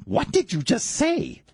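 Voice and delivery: very high, slow mock outrage; hammed-up affronted repeat; emphatic disbelief. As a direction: draw out each word, incredulous. Retold reaction, repeated harder.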